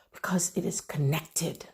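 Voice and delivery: a soft, breathy hush of a whisper. Acted. Preacher whispering to the congregation about connection. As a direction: lean in and whisper it like a secret.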